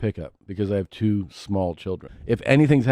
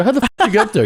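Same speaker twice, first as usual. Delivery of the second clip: shocked question; 13 semitones higher, 7 dB louder, much faster than usual. Retold or imitated. Dad retells shocked question to son.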